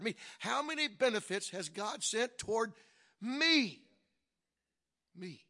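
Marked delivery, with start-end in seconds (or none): 0.4-3.8 "me" set apart after a pause, loud and high; stress on "me". Shown as a demonstration. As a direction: punch a final, louder "me" after a short pause. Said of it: "put some emphasis on the last word".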